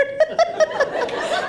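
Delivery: high giggle; a quick high burst of laughter. Real, not acted. Laughs about menopause.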